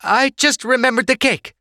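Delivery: high, loud flustered excuse. A narrator's reading. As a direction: blurt out a cover story. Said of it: "Sorsha stumbled over her words".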